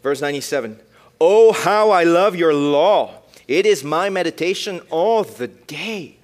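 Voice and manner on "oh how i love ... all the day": loud, slow and devoted; fervent scripture reading. Acted. Preacher reading Psalm 119:97 to the youth, fervent and slow. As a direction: proclaim the love of the law.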